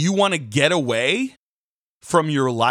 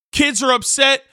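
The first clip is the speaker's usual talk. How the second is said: ordinary talk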